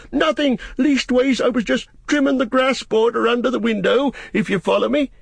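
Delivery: very high gardener's voice; flustered Sam, making excuses. Acted. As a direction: play innocent in a high, anxious pitch.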